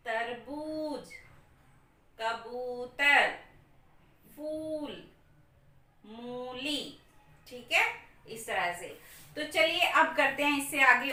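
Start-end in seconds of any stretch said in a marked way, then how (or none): 0.1-7.0 तरबूज and कबूतर said slowly, long ऊ held; teacher stretching long vowels for pupils; slow drawn-out words. Shown as a demonstration. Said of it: "वो थोड़ी लंबी आ रही है".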